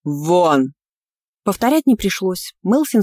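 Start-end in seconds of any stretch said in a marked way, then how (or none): 0.0-0.7 furious. A narrator's reading. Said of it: "разъярённое".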